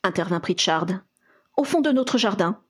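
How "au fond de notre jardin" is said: plain reading voice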